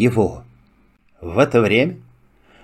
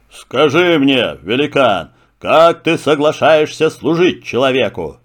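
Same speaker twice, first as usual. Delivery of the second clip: lion voice. Acted. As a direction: speak as a calm king of beasts. Lion speaks. (голос льва)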